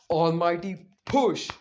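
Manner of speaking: loud, drawn-out technique shout; Naruto's attack call. Acted. Naruto unleashing his technique, slow loud call. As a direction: call out the move, stretched and forceful.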